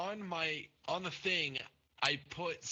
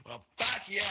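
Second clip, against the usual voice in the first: retold angry outburst, higher and louder; indignant outburst; 6 semitones higher, 6 dB louder than usual. Rejected date storming off from the truck, mock angry outburst. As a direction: mimic an angry send-off, raised voice. Acted angry parting shot.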